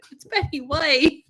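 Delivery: high, loud mock protest; hammed-up exasperated fan; indignant plea. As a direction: insist she matters so much. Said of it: "I'm like".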